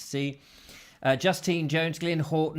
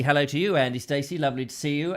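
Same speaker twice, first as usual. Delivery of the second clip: normal talking voice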